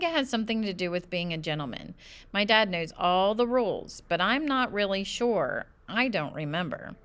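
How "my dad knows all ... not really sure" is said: ordinary delivery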